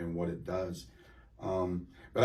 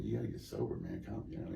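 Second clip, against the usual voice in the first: lower, soft voice of God speaking to him; a hint of a quiet inner God voice; gentle low urging from above; 3 semitones lower, 9 dB quieter than usual. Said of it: "God was saying".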